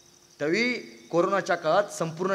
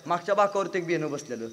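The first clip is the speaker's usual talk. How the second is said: normal talking voice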